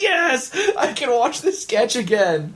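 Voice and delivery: excited. Retold or imitated. Delight at finding sketch.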